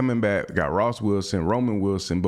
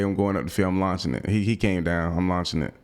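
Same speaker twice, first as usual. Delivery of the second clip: normal talking voice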